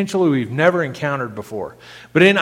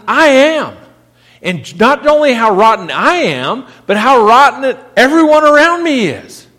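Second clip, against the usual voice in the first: louder, higher, crying out the lament; impassioned preaching; 4 semitones higher, 5 dB louder than usual. Acted. Preacher crying out Isaiah's lament to the congregation, impassioned. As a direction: pour out Isaiah's despair at full force.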